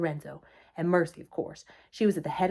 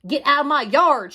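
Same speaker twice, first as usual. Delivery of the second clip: loud, high-pitched angry order; shout; 7 semitones higher, 9 dB louder than usual. A narrator's reading. Eugenia orders the pig Mercy out of her yard, shouting. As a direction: shout it at the intruder. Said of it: "she shouted at Mercy".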